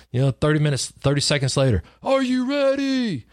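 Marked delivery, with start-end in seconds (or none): 2.0-3.2 high, slow, drawn-out hype call; instructor hyping. Retold or imitated. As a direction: stretch it out like a pumped-up instructor.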